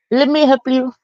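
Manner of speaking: high, polite call-center voice; lightly hammed customer service voice; mock tech support. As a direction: parody a support agent on the phone. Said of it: "You sound like a fucking Amazon".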